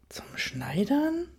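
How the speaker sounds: slow, high, rising echo question; drawn-out disbelieving question, pitch climbing; incredulous. A narrator's reading. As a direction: repeat it back in utter disbelief. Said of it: "fragte ich ungläubig zurück".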